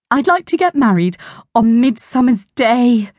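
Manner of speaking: high, far-away dreamy voice; dreamily. A narrator's reading. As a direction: drift off into a daydream. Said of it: "said Auntie Glo dreamily".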